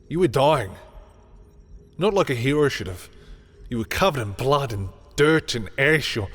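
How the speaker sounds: anguished outburst. Acted. Telling their friend how they lay dying, loud anguish. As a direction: pour it out in rising anguish, loud and high.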